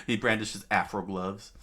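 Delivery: real giggle through the words; laughing. Real, not acted. Reader amused by the afro gloves, laughing narration. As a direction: still cracking up while narrating. Reader cracks up at own phrase.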